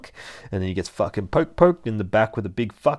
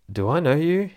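Jason voice; 8 semitones higher, 4 dB louder than usual. Retold or imitated. Jason voiced in comic retelling.

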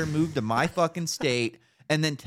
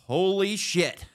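slow amazed exclamation; 8 semitones higher, slower than usual. Real, not acted. Amazed at the new look.

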